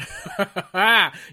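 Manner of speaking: loud, high burst of laughter at herself; real laugh. Real, not acted. Joking about sweating on camera: loud real laugh. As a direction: laugh it off, embarrassed.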